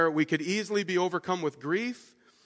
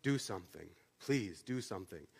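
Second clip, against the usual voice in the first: quiet plea; 8 semitones lower, 11 dB quieter than usual. Retold or imitated.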